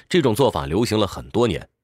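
ordinary delivery

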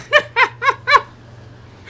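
high, loud staccato cackle; sudden squealing laugh; burst of laughter. Real, not acted. Game goes absurdly wrong.

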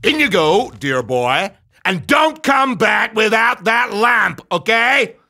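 high, loud villainous send-off; loud order. Acted. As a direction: send him in with a drawn-out shouted warning. Abanazar orders the boy inside.

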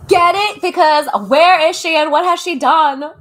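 loud punchline. Real, not acted. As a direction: hit the joke loud and incredulous. Punchline after silent Kamala impression.

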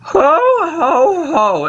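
high put-on old-lady chuckle; old mother's laugh. Mother character laughing at son's words, high old-lady voice.

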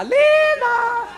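high loud call for Linda; hammed-up frantic call. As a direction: shout her name into the dark house.

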